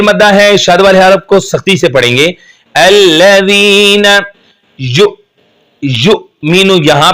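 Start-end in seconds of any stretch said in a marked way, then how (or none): 2.7-4.2 अल्लज़ीना with a clear dal, drawn out slowly; correct dal sound. Shown as a demonstration. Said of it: "अल्लज़ी नहीं पढ़ेंगे".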